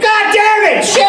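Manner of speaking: high-pitched frustrated cursing; enraged outburst. Acted. Lego builder cursing a lost piece, enraged. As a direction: explode in mock rage, pitch shooting up.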